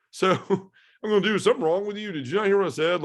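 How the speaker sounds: high, loud disbelief with a laugh; incredulous protest. Retold or imitated. Man answering a friend's absurd suggestion, incredulous.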